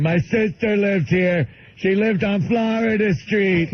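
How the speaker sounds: slow, high simple-man voice; drawn-out, insistent caricature; Bill. Retold or imitated. Bill riding with his friend, slow high insistent caricature. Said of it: "he kept saying".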